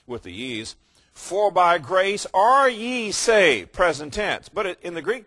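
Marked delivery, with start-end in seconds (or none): 1.1-3.7 higher, louder, slow King James English; old King James reading. Shown as a demonstration. Preacher contrasting old King James wording, slow and loud. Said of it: "You take the old King James and it would say".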